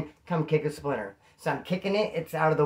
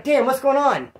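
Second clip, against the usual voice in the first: higher, louder mock alarm; acted indignant surprise; retold startled protest; 8 semitones higher, 6 dB louder than usual. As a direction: protest as if suddenly handcuffed. Acted protest at being handcuffed.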